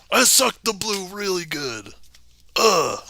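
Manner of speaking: high, slow surfer drawl; surfer dude voice. Retold or imitated. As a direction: stretch it out like a stoned surfer. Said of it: "I read that like a surfer dude".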